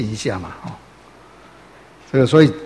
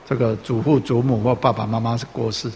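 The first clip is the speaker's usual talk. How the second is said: ordinary talk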